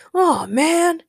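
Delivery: high, loud whine of disappointment; complaining. A narrator's reading. Ray complains as the free garlic stops, whining. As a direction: let out a put-out complaint. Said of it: "complained Ray".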